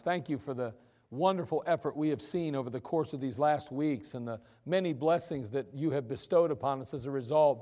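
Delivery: soft, low closing prayer. Acted. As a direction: pray quietly and earnestly.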